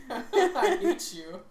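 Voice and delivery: laughing. Acted. Mock rant at Willis Tower.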